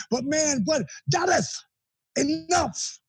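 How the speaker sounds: high strained mock yell, Dusty cutting him off; sudden exasperated outburst; retold yell. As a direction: snap out high like an exasperated mentor. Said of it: "He yelled at me".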